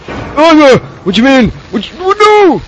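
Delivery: high panicked screaming; scared horror game streamer. Real, not acted. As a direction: scream in panic while chased.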